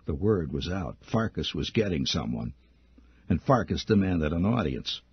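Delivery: ordinary talk, nothing marked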